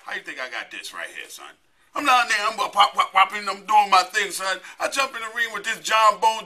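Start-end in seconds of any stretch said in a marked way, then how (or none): none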